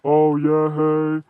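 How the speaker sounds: put-on high, loud kid voice on voicemail; a kid's lazy voicemail greeting; imitating little brother. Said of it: "He's like".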